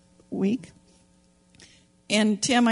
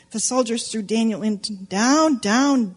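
ordinary talk, nothing marked

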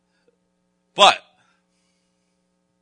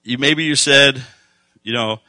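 loud, driving preaching, slowing on key lines; full-force preaching; 10 dB louder than usual. Acted. Preacher urging the congregation, loud and driving. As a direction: press the message home to the congregation.

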